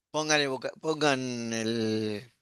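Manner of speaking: normal talking voice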